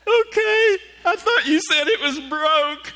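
put-on high, slow, teary boy voice; a lightly played crying child; tearful kid. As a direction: sniffle it out like a hurt kid. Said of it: "he's like".

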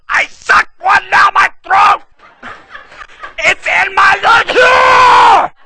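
high, loud, slow strained cry; panicked wife. Retold or imitated. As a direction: gasp it out as if choking. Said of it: "She goes".

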